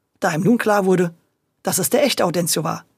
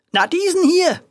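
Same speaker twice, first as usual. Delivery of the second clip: high, louder indignant cry; angry outburst; 11 semitones higher, 3 dB louder than usual. A narrator's reading. Grocer pointing out the boy to the officer, indignant high cry. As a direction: jab the words out in anger. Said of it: "rief Herr Vegettini immer noch ganz erbost".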